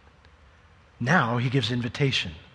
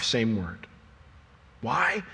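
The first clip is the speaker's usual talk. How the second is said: usual speaking voice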